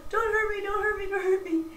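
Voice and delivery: high, loud, squealing mock panic; imitating a friend begging not to be crushed; mock frightened plea. Friend pleading with an overexcited hugger, high mock panic. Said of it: "She goes".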